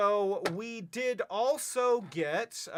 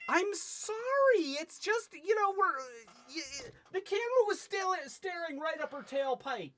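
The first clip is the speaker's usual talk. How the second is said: high flustered voice; 10 semitones higher than usual. Pretend. Flustered high-pitched apology.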